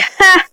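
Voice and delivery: high, mocking "ha"; mock laugh. Classmate jeering at his subscriber count, mock laugh. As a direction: imitate a scornful one-syllable laugh. Retelling boy's scornful laugh.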